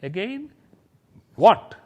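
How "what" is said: "what" short, loud and punched; questioning word punched. Shown as a demonstration. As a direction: fire out "what" as a sudden question. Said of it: "The moment you say what".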